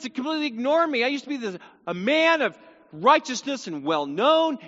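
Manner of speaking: high, hammed-up complaining Job; mock lament. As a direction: wail every grievance one after another. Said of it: "the way this feels".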